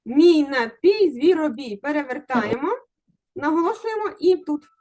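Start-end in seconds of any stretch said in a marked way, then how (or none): 0.0-1.1 "Мій напій" slow, stress on "пій"; slow stress demo. Shown as a demonstration. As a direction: stretch out "наПІЙ" with a rise. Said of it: "наголошуйте тепер правильно".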